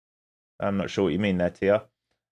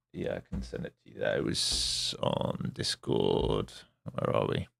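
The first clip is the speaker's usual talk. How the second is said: aside; 9 semitones lower, 9 dB quieter than usual. Real, not acted. Muttering away from the mic.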